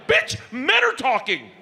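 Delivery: high, slow mock outrage; hammed-up exasperated husband; indignant comeback. Husband snapping back at wife.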